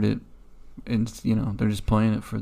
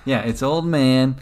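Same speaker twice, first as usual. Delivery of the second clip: ordinary talk, nothing marked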